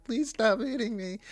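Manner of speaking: high mock pleading man; mock whiny plea. Boyfriend begging girlfriend on stream, mock high plea. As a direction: whimper it in a mocking high voice. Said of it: "and he's like".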